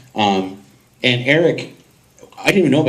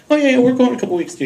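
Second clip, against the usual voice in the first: breezy wife imitation; 11 semitones higher, much faster than usual. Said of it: "My wife said".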